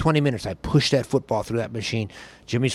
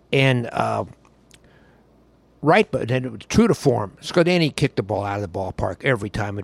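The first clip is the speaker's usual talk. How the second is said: normal talking voice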